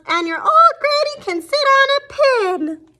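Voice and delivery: high, loud pig voice taunting; pig yelling insult. Acted. Third pig insults the wolf's granny, high yell. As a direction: jeer it from inside. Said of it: "the third little pig yelled".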